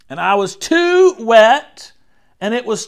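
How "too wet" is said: "too" high, loud and drawn out; complaining stress. A narrator's reading. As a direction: whine loudly on a long "too". Child complaining.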